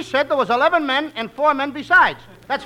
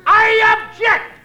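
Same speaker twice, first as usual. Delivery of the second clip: loud, high, slow protest; loud objection; 9 semitones higher, 7 dB louder, much slower than usual. Acted. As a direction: shout the objection. [name] objects in court.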